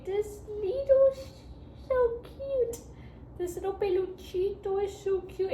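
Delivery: high, squeaky gushing over a toy; gushing over the koala plush from her supervisor, high and squeaky; cute squeal. Real, not acted.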